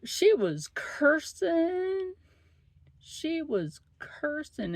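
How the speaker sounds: high, slow and drawn out in disbelief; incredulous. Real, not acted. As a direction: stretch it out, astonished. Retelling the patient's cursing outburst.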